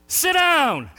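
loud, high sharp command. Retold or imitated. Young preacher ordering the heckler down, loud high command. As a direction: order him to sit, hard. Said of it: "So I said".

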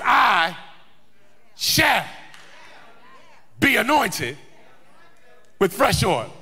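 firm proclamation broken by long pauses; faith-filled declaration. Acted. Preacher showing the faith in the psalmist's words, confident declaration. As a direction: declare it one word at a time. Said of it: "the faith that you hear when he declares".

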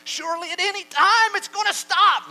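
high, shouted protest; loud, high-pitched imagined plea; desperate objector. Acted. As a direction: cry out in mock desperation. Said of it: "And you'll think".

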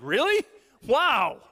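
high astonished really wow; mock surprise. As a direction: act amazed that God answered. Surprised reaction to answered prayer.

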